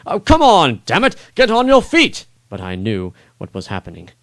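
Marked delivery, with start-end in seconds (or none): 0.1-2.3 high, loud frustrated outburst; furious king shouting from the stands; exasperated exclaim. Acted. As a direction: yell at the fallen knight in disbelief. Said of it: "couldn't help but exclaim".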